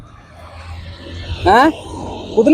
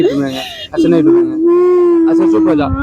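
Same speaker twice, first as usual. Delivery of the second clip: mock wailing; 7 semitones higher than usual. Mock crying, teasing.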